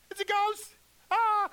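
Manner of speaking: high, loud terrified yell with a scream; frightened shout. Retold or imitated. Disciples spotting a ghost on the water, terrified shout. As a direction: cry out in panic. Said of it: "they shouted".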